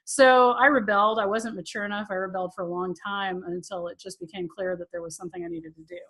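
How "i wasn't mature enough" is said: normal talking voice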